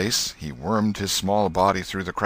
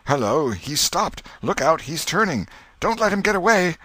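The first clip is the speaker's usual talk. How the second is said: higher, urgent crowd calls; crowd shouting; 10 semitones higher, 4 dB louder than usual. Acted. Crowd at the graveyard warns he is turning, urgent shouting. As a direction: warn each other he may run, rising to a yell.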